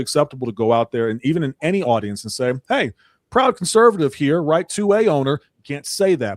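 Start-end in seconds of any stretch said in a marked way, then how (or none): 2.7-5.4 high, loud proud declaration; bold announcement. Acted. Conservative declaring herself to an audience, loud and proud. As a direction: announce yourself to the whole room.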